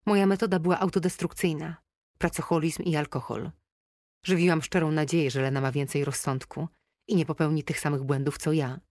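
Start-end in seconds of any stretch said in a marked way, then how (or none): none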